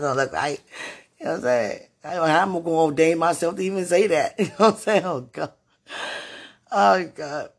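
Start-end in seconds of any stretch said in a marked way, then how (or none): 2.0-7.5 loud, fast, laughing disbelief; excited laughing. Real, not acted. Laughing about ordaining her own wedding.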